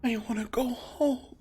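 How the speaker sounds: lost spirit voice. Acted. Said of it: "You begin to hear".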